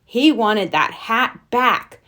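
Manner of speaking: plain reading voice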